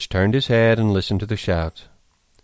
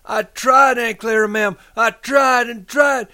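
high, loud wail, slowing at the end; miserable pleading; 14 semitones higher, 8 dB louder than usual. A narrator's reading. Lenny protesting to Aunt Clara, miserable and high. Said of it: "said Lenny miserably".

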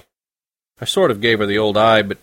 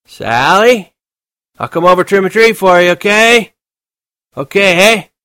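put-on drunken shouting; 10 semitones higher, 8 dB louder than usual. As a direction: slur it loud into the phone, very drunk. Drunk Holden.

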